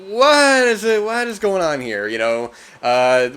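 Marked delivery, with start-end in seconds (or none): 0.1-2.1 high, loud mock bafflement; baffled outburst. Reviewer mocking the sisters reveal, high baffled cry. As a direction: squeal out a confused what is going on. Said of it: "and it's like".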